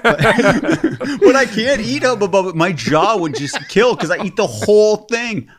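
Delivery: high-pitched words through laughter; laughing protest. Real, not acted. Joking with co-hosts about chewing gum, laughing protest. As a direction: protest while cracking up.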